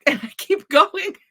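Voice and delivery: usual speaking voice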